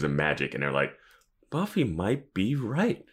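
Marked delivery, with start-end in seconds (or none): 1.5-3.0 high, slow, wondering; hammed-up tentative awe; mock realisation. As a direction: draw it out as if it just dawned. Mocking characters' slow realisation.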